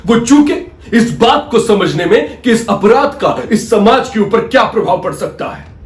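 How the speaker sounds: loud, rising courtroom oratory; impassioned accusation. Acted. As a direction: hammer each charge home to the judge. Lawyer hammers opponent's failures in court.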